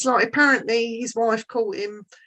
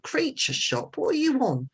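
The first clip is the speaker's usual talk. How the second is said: high-pitched incredulous query, retold; baffled disbelief; 6 semitones higher, 4 dB quieter than usual. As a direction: echo it back as a puzzled question, pitched up. Baffled reaction to sleep talker.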